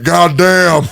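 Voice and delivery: wrestling star cheering a voice impression, loud; Stone Cold impression. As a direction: Austin hyping it up with a loud brother. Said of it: "He was like".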